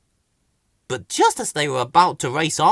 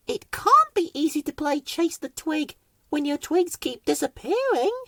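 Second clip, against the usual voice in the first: much higher, soft little plant voice; Weed's voice; 12 semitones higher, 3 dB quieter than usual. A narrator's reading. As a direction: speak gently, high and small. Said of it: "said Weed".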